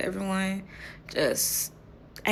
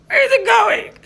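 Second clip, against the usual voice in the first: incredulous question; 14 semitones higher, 8 dB louder than usual. Real, not acted. Amused confusion about photos.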